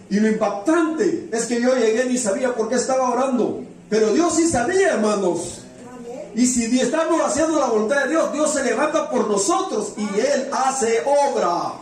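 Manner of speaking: high-pitched, impassioned preaching climax; fervent preaching. Real, not acted. Preacher builds to climax of testimony. (predicación ferviente, con el tono muy alto)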